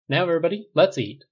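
rally everyone to dig in; cheerful call. A narrator's reading. Missus Rhodes calling the guests to eat, bright and loud.

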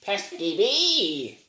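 slow, high squawk; bird impression. Quoting the bird from Don't Hug Me, slow squawk. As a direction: squawk it out slowly like the puppet bird. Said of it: "the bird from Don't Hug Me".

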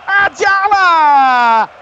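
building to a huge stretched shout; explosive goal commentary. Real, not acted. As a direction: roar the goal call, dragging the name out. Long-range goal being scored.

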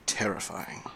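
soft, dry sarcasm; sarcastic. Acted. Villain answers the Doctor's threat of Time Lord wrath, softly sarcastic. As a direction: feign being terrified, softly and unimpressed. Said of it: "a heavy hint of sarcasm in that voice".

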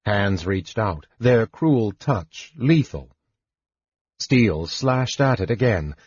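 ordinary delivery

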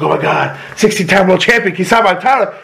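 imitating a thrilled little fan, pitch climbing; stoked kid. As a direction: gush like a starstruck kid, rising as it goes. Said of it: "so stoked, like".

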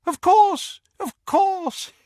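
put-on old wizard Doge greets Harry eagerly, high and wheezy. A narrator's reading. Eager old Doge to Harry, high-pitched and wheezy. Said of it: "He had a rather high-pitched, wheezy voice".

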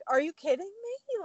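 high, incredulous retold reaction; incredulous, pitched well up; disbelief. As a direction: act out being stunned by good news. Retelling disbelief at being picked.

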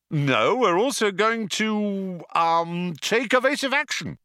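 high, slightly slowed, a touch of panic; stalling as fear rises; sudden panic. A narrator's reading. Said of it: "with a sudden access of panic".

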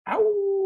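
high-pitched wolf howl; excited howl. Real, not acted. Celebrating an eighteen-fang score.

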